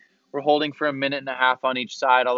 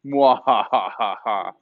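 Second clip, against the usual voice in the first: slow, low evil cackle; drawn-out villain laugh; mad scientist; 4 semitones lower, slower than usual. Pretend. Teasing frog-pose holders, evil laugh.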